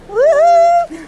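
very high, loud whoop; a real burst of glee for the dogs; excited woo. Real, not acted. Cheering the dogs on arrival.

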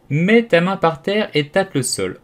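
high, loud, measured command; commanding order. A narrator's reading. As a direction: give a slow, emphatic order. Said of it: "ordonna le lys tigré".

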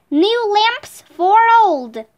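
drawn-out street cry; slow calling out. A narrator's reading. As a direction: call it out slowly, like a seller. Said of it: "called out".

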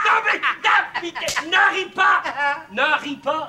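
scolding. Acted. Devil scolds the laughing puppet.

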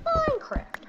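very high squeaky list-chanting; mock Minecraft kids. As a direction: squeal each word like an overexcited kid. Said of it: "half of the people are gonna rush to you and say".

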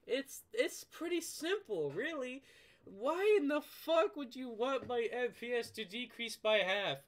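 high, loud furious outburst; angry rant. Real, not acted. Gamer yelling at Vanguard players, furious and high. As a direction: let the anger boil over at them.

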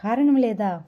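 plain reading voice

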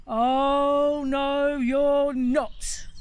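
loud, booming goat reply; big goat answering the troll, thunderous and loud. Acted. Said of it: "in a voice like thunder".

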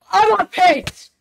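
excited shout. Real, not acted. Runner hits personal best pace.

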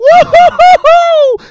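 very high, loud held "wouhou" whoop; hammed-up falsetto cheer in pulses; excited whoop. Host cheering the third technique, whooping.

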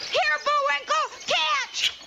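calling out. Acted. Throws something from the cart.

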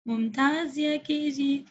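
normal talking voice

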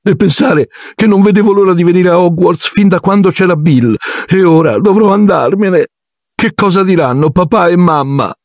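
put-on high, tearful girl's voice; a girl sobbing in despair. A narrator's reading. Ginny sobs to Harry about being expelled, high and tearful. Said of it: "singhiozzava Ginny".

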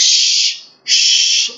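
a long hissed শ alone; isolated sh. Shown as a demonstration. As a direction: hold the শ sound, voiceless. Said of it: "একটা sound আসতেছে".

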